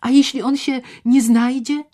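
naive cheek. Acted. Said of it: "pytała z naiwną bezczelnością".

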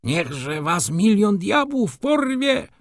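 high, drawn-out curse; furious cry. A narrator's reading. Pan Ignacy cursing the shop assistants, crying out furiously. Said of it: "krzyknął pan Ignacy".

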